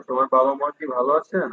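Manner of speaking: ordinary talk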